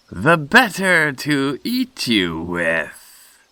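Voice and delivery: slow, high-starting, drawn-out threat; menacing wolf. Acted. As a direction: relish each word as the hungry wolf. Wolf reveals his intent.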